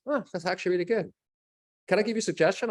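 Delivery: lightly voiced helpful actress; imitating Allison Janney. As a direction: chirp it quickly in her voice. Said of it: "And she goes".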